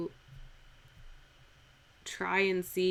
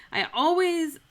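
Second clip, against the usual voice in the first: normal talking voice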